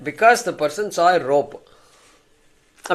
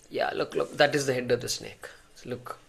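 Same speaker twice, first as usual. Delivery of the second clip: hushed and fearful, much softer; scared hush; 3 semitones lower, 8 dB quieter than usual. Acted. Frightened man warns his rescuer, hushed and scared. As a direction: whisper it like the snake might hear.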